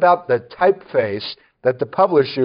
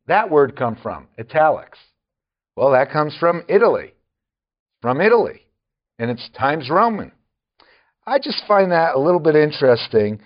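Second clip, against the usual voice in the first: ordinary talk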